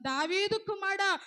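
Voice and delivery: loud, high desperate cry for help; a blind beggar shouting to be heard over the crowd; blind man crying out. Acted. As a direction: call out to him across the crowd. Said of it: "కేకలు వేస్తున్నాడంట".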